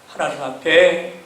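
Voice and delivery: ordinary talk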